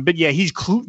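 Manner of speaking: normal talking voice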